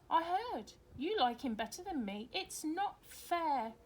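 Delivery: put-on a jealous girl whining that it's unfair; a lightly voiced sulky daughter to her mum; sulky child. Laura complaining, jealous of Lester.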